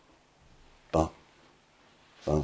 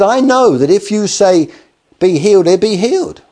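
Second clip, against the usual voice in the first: higher and louder, fervent certainty; eager conviction; 10 semitones higher, 6 dB louder than usual. Retold or imitated. Centurion telling Jesus his servant will be healed, fervently. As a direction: say it with full believing fire.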